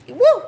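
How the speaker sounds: high, loud woo; excited woo. Real, not acted. Reader fanning herself, a loud high woo. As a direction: let out a whoop. Flustered by sexy reading.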